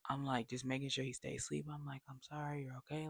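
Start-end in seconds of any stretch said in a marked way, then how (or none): 2.1-3.1 softly hushed comfort; soothing a baby. Retold or imitated. Soothing the sleeping baby in retelling.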